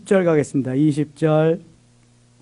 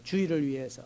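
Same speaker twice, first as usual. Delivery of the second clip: 주일을 위해서 repeated much softer; 주일을 위해서 echoed quietly; 10 dB quieter than usual. Shown as a demonstration. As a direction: drop the repeat to a soft echo.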